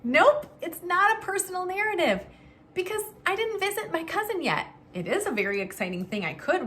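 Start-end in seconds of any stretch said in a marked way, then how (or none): 0.0-4.6 high bright nope; excited. Acted. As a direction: cheer the right answer. Teacher confirms the kids' answer.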